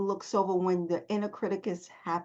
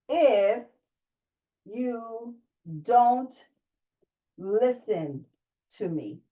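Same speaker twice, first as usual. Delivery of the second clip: what are you afraid of if you don't listen to me dragged out, pausing between words; a slow, paused, deliberate question; much slower than usual. Shown as a demonstration. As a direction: spell out each word slowly with long pauses. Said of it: "Second question".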